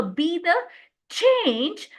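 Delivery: pause, then CHANGE pitched high; stress on change. Shown as a demonstration. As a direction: set change apart and lift it high. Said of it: "I'm laying the stress on the word change".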